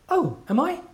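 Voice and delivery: surprised lady. Retold or imitated. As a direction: raise the pitch for her surprised reply.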